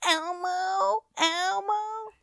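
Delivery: put-on high, drawn-out whine of a toddler; toddler impression. Mom voices her almost-two-year-old not listening, whiny toddler voice. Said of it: "she's just like".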